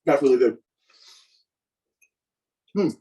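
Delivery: talking through a mouthful of food; mouth full. Real, not acted. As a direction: say it while still chewing. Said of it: "So I don't talk my mouth full".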